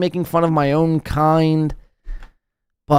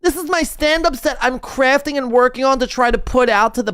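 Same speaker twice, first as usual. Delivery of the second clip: mock stand-up comic; 7 semitones higher than usual.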